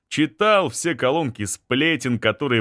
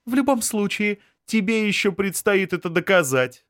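defiant woman; 8 semitones higher than usual. Acted. Anzhela defiant.

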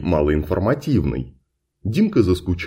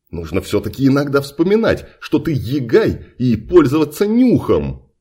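ordinary delivery

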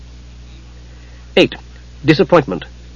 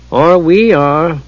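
gloomily; 4 semitones higher, much slower than usual. A narrator's reading. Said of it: "Gillian added gloomily".

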